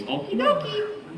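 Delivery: high cartoon Mario "okie dokie"; a quick Mario impression for the panel. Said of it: "I can do Mario's voice".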